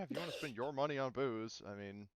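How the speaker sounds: ordinary talk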